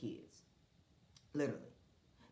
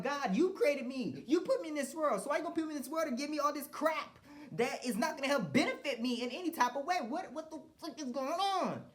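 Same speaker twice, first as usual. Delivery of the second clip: much higher and louder, furious pleading; angry prayer to God; 11 semitones higher, 4 dB louder than usual. Retold or imitated. Railing at God who put him here, loud and high. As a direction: cry out at God, high and raw. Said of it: "I'm sitting up here like".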